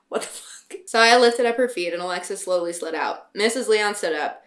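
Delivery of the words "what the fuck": whispered aside. Real, not acted. Reader's aside at own story.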